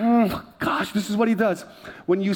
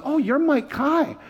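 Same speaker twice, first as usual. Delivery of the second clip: put-on high, slow child's voice; little kid; 8 semitones higher, slower than usual. Six-year-old greets youth pastor, high imitated kid voice. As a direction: imitate a small boy recognizing someone. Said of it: "he looks up to me and he goes".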